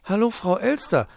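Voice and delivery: high chirpy little bird. Acted. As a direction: chirp a greeting. Bird greets.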